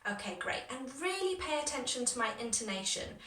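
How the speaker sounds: ordinary talk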